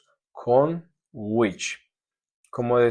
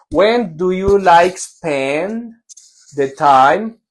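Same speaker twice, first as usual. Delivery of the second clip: slow read of a wrong sentence; reading out student error; 4 semitones higher, 4 dB louder, much slower than usual. Shown as a demonstration. Teacher reading a student's wrong answer aloud, slowly. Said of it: "quítale ese the".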